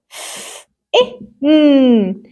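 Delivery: "ف-، إيه، ن" as separate f, short i, held n; sounding out. Shown as a demonstration. Teacher spells out fin for pupils, sounding it out. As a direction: split "ف-، إيه، ن" sound by sound, slowly. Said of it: "Let's try to read this word".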